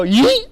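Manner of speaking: loud high yelp; a sudden loud burst for the horse; horse's sudden launch. Retold or imitated. Voicing the horse as it surges, a loud yelp. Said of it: "Then he would go".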